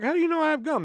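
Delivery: an imitated high, incredulous squeak; surprised question. To classmates begging gum, a surprised high question. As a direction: pitch jumps way up in mock disbelief.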